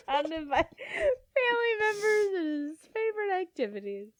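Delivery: words breaking into giggles; laughing. Real, not acted. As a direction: repeat it through laughter. Repeats line while laughing.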